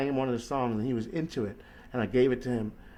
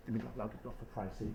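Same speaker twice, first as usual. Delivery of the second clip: much softer, fast, half under the breath; quick quiet aside; 4 semitones lower, 16 dB quieter, faster than usual. Real, not acted. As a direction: toss it off quietly while doing something else. Quick aside while locking the door.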